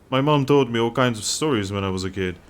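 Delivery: ordinary talk, nothing marked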